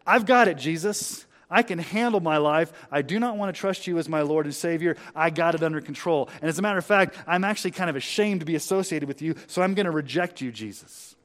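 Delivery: loud, emphatic voice of a proud refuser; acting out defiant self-reliance; prideful, self-sufficient. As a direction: brush Jesus off with loud self-assurance. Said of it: "you are so prideful, you are so self-sufficient".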